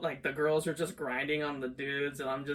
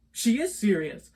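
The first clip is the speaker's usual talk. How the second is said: loud, raised mock disbelief; retold disbelief; 6 semitones higher, 9 dB louder than usual. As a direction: relive the disbelief, louder and higher.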